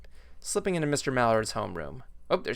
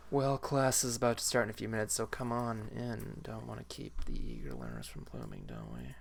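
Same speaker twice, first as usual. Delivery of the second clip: lazy stoner teacher voice; 3 semitones lower, 8 dB quieter than usual. Acted. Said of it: "It's my best stoner voice".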